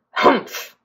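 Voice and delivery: short huffed grunt; voiceless grumpy harrumph. A narrator's reading. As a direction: huff like a storybook grump. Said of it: "grunted Mister Grumpy".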